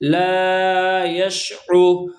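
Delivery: لا یشعو with the la and the u both held long; drawn-out recitation. Shown as a demonstration. Teacher drawing out لا یشعو for students. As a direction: stretch both vowels in لا یشعو slowly. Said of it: "ہم نے یہاں لا کو بھی کھینچا".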